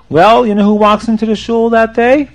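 usual speaking voice

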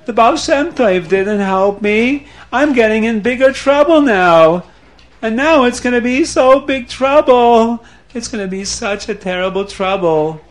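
voiced as a mildly crying, upset man; distraught lament. A narrator's reading. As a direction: panic about the coming disaster. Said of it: "and he's crying, and he's very upset".

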